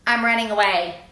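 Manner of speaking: a touch of a bold little bunny; lightly voiced bunny declaring it; bunny announcing. A narrator's reading. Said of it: "he said to his mother".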